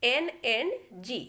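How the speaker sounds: very slow, careful reading; slow rule reading. Shown as a demonstration. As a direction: dictate the rule slowly. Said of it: "rule one कहता है". (धीरे धीरे नियम पढ़ना)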